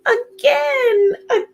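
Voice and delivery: very high little pea squeal; gleeful little scream. A narrator's reading. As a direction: squeal it like a tiny excited child. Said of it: "Little P would scream".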